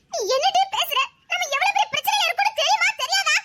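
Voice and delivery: high-pitched, exasperated telling-off; agitated scolding. Acted. Woman rebukes friend over the trouble they are in, agitated scolding.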